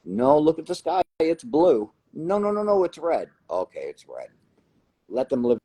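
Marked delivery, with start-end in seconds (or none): 0.1-1.9 higher, louder exasperated insistence; insisting. Retold or imitated. Arguing with a naysayer about the sky, exasperated insistence. 2.1-3.3 high, whiny contrary no no no; mock the stubborn naysayer. Mimicking naysayers who deny the obvious, whiny mockery. 3.5-4.3 resigned. Retold or imitated. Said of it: "giving in".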